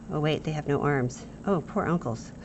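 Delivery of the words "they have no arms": usual speaking voice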